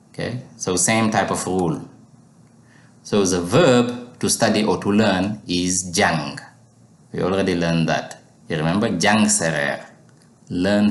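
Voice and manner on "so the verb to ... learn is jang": normal talking voice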